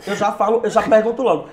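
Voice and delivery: ordinary talk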